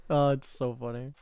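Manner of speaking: usual speaking voice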